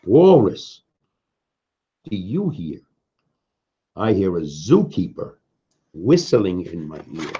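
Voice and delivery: usual speaking voice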